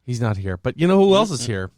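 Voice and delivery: normal talking voice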